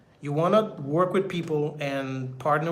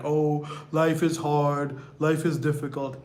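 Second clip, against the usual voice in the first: mock self-pity; slower than usual. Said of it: "they're like".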